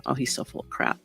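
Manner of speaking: under-the-breath scoff; whispered aside. Real, not acted. Whispered aside while reading article.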